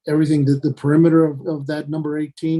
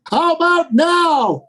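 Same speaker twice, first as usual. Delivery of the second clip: calling out; 13 semitones higher, 7 dB louder, much slower than usual. Real, not acted. Mic check to remote caller.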